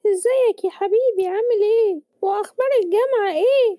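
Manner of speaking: high-pitched mother's voice; affectionate mother. Acted. Mother asking her son how university is, high-pitched motherly voice.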